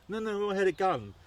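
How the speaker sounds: high, loud imitated child's protest; frantic confession. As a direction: blurt it out like a panicked kid.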